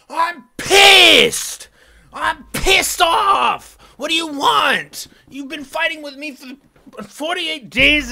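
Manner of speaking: high, loud, drawn-out angry yelling; re-enacted furious shouting. Grown child snaps at mother after two years of fighting, furious shout. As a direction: act out snapping, bellowing the key words.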